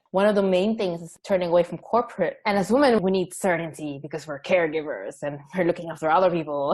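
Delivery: usual speaking voice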